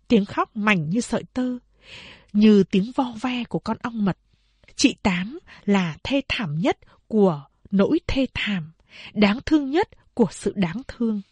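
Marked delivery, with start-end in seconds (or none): none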